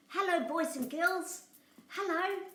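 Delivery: high cheery puppet voice; puppet greeting. Pretend.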